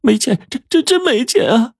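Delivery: very high, stammering anxious woman; anxious protest. A narrator's reading. Woman refusing to hand her bag to the robber, anxious. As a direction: protest urgently, pitch way up, tripping over the words. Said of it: "她着急地说".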